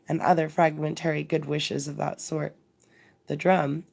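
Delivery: ordinary delivery, nothing marked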